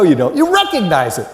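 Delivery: usual speaking voice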